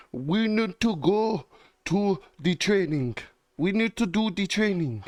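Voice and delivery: high, slow mock-nagging woman; pushy trainer impression. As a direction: push them along in a high put-on voice. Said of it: "she's so pushy about it".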